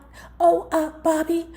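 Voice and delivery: mock giddy phone voice. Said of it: "giddy on the inside".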